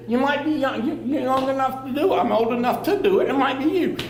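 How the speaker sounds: ordinary talk, nothing marked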